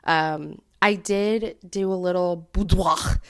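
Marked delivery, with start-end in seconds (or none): 2.5-3.2 boudoir in a hammed-up French accent; affected French accent. Shown as a demonstration. Telling viewers about a photo shoot, affected French accent. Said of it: "very affected French accent".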